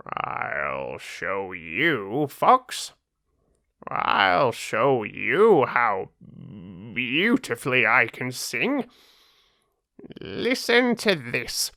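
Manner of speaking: a vain crow about to prove herself; boastful crow. Acted. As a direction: show off, offended vanity.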